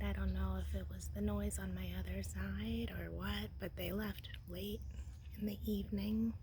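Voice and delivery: soft early-morning whisper; hushed, keeping the voice down. Real, not acted.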